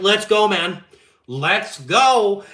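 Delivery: rally your buddy to hit the road; egging on his new buddy to go buy the RV, loud and pumped; hyped. Retold or imitated.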